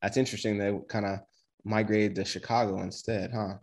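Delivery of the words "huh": usual speaking voice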